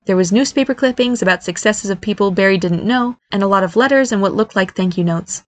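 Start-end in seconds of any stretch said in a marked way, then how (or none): none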